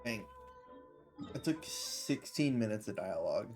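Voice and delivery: usual speaking voice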